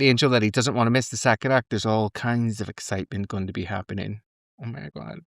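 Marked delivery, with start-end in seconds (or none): none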